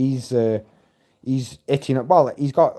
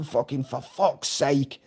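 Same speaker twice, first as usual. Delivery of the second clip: slow, softer exasperated grumble; inconvenienced annoyance; 5 dB quieter, slower than usual. Retold or imitated. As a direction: re-enact being put out by a nuisance. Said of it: "it was like a hindrance".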